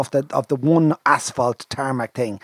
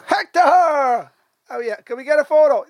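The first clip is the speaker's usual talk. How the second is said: excited; 13 semitones higher, 4 dB louder than usual. Retold or imitated. Fan spots him at stand.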